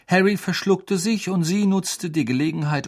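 plain reading voice

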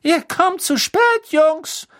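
very high, squeaky teacher voice; tadelnder Professor. A narrator's reading. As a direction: scold latecomers in a piping voice. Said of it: "tadelte sie Professor Flitwick". (tadelnd mit hoher Piepsstimme)

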